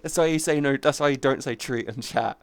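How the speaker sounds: usual speaking voice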